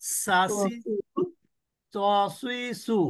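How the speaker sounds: সাসি জু তসুই সু spelled out slowly; slow letter drill. Shown as a demonstration. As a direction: drill each syllable slowly. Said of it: "কি কি অক্ষর ছিল?". (ধীরে ধীরে অক্ষর উচ্চারণ)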